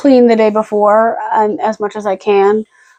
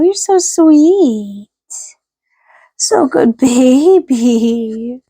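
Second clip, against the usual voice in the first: slow doting coo; 2 semitones higher, much slower than usual. Real, not acted. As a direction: melt over your dog. Praising the dog slowly.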